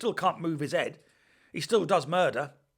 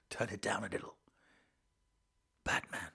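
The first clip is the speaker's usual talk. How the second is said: low hushed Batman whisper; Pattinson whisper; 8 semitones lower, 9 dB quieter than usual. Retold or imitated. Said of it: "Pattinson seems to have turned it right down".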